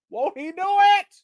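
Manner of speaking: high praise call; church praise. Real, not acted. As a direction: shout it like church testimony. Gospel praise catchphrase.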